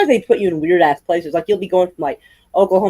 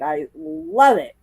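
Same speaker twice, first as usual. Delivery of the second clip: ordinary talk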